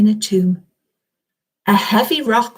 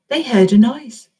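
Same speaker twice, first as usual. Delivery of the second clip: ordinary delivery, nothing marked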